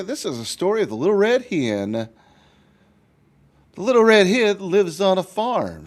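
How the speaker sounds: put-on lively storybook narration for kids; reading to children. A narrator's reading. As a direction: read it like a picture book to a class. Teacher reads story to children.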